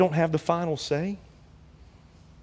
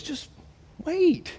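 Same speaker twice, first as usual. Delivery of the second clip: WAIT leaps up high; God voiced with a knowing lift; drawn-out wait; 9 semitones higher than usual. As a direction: hold them off with a playful high "wait". God quoted, teasing promise.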